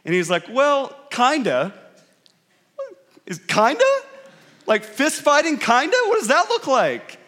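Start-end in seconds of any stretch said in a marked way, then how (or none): 0.5-1.7 hedging. Retold or imitated. Retold evasive answer, drawn out. 3.2-7.2 high-pitched baffled questioning; incredulous. Retold or imitated. Retold disbelief at evasive answer.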